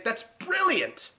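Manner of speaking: high, drawn-out sarcastic delight; mock amazement. Mimicking someone who thought up the monkey movie, mock amazement. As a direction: stretch it out high in mock awe. Mocking whoever pitched the film.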